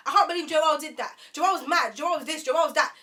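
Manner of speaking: high, loud, repetitive griping; mock phone ranting. Imitating the date's phone rant, loud and worked-up. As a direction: imitate someone carrying on about a friend.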